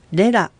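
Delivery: レラ with the accent on レ; accent on first syllable. Shown as a demonstration. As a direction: model レラ, stressing the first syllable. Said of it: "アクセントの位置は第一音節になります".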